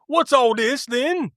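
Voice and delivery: mock Cockney cop. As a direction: play the Cockney policeman. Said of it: "It's like a Cockney accent".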